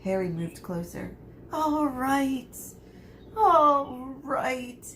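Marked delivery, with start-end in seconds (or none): 1.5-2.6 high, tearful surrender; sobbing give-in. A narrator's reading. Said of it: "he heard Quirrell sob". 3.4-4.8 high and louder, tearful surrender; sobbing give-in. A narrator's reading. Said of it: "he heard Quirrell sob".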